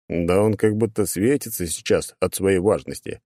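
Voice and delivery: usual speaking voice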